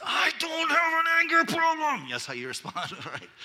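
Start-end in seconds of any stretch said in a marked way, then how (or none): 0.0-2.1 much higher, louder defensive snap; accused spouse denying it defensively; defensive denial. Retold or imitated. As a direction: deny it hotly. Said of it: "the way you respond is".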